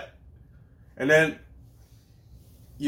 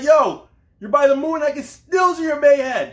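very high, loud shout; shouting across space; 14 semitones higher, 8 dB louder than usual. Retold or imitated. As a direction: yell it all the way to the moon. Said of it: "He's like".

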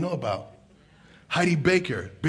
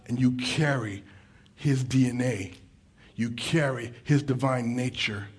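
intense; 4 semitones lower, 5 dB quieter than usual. Real, not acted. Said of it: "I'm sorry if I sound really intense".

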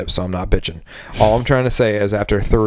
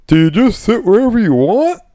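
higher, sing-song lost customer; clueless customer; 10 semitones higher, 3 dB louder than usual. Retold or imitated. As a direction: ask it like a helpless tourist. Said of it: "the customer that walks in and goes".

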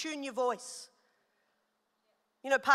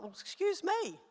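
mock offended; 5 semitones higher, 3 dB quieter, slower than usual. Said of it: "I'm like".

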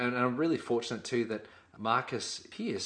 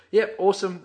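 higher and louder, a bright mock "Yep, awesome"; imitating an upbeat reply; 8 semitones higher, 9 dB louder than usual. Co-host imitates partner's upbeat reply, higher and louder. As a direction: play the eager mate answering with a punchy "Yep, awesome". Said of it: "You go".

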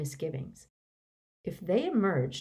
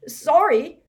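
higher, loud startled apology; gasped; 10 semitones higher, 10 dB louder than usual. A narrator's reading. Balding wizard backing away from Runcorn, gasping. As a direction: gasp it in fright. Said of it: "gasped the balding wizard".

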